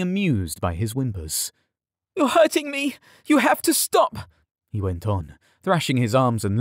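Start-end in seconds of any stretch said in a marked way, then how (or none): 2.2-4.3 very high, desperate cries; pained pleading. A narrator's reading. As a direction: plead through pain to be let go. Yared pleads, crushed and tearful.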